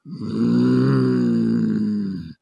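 low rumbling growl from the throat; deep raccoon growl. Acted. Raccoon growling down at the frog, deep growl. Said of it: "growls. And this is a lot deeper".

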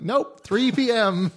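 high, drawn-out hotel clerk's no; slow, high-pitched mock refusal; mock curt refusal. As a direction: imitate a clerk turning someone down. Acts hotel clerk refusing early check-in.

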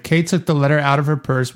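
ordinary talk, nothing marked

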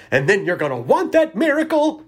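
a light hint of Bill Murray's Scrooged rant; Bill Murray impression. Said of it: "I feel like I've summoned Bill Murray from Scrooged".

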